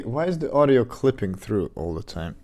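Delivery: normal talking voice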